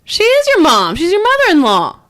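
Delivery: loud, high-pitched insistence; exasperated, high and loud correction; emphatic protest. Real, not acted. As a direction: raise your voice to set them straight. Arguing back about the mother-in-law.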